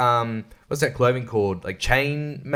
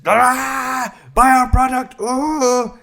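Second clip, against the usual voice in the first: high-pitched, put-on advert voice; mock radio ad; 9 semitones higher, much slower than usual. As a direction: parody an annoying ad with a high wail. Mocking annoying radio ads.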